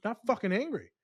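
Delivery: retold angry denial at the professor; retold angry outburst. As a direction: replay the yell at the professor, half-heartedly. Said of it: "I said".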